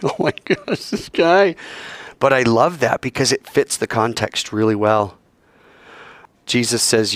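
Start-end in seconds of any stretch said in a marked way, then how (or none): 0.0-1.5 exasperated disciples. Retold or imitated. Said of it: "they're like".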